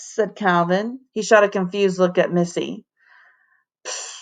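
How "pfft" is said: unimpressed breathy pfft; dismissive pfft. Acted. Bored girl scoffs at the field trip, a soft pfft. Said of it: "said Rachel".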